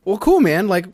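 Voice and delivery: high, loud, oblivious friend; loud casual reply. Acted. Friend answering "I play WoW", loud and casual. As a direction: answer the whisperer at full voice. Acted upbeat reply to the whisperer.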